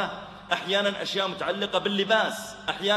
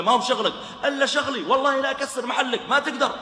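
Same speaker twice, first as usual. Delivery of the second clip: raised, loud and high angry shout; angry quarrel; 8 semitones higher, 5 dB louder than usual. Acted. Shop owner yelling at the man who scolded him, loud and angry. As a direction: shout back like an insulted shopkeeper.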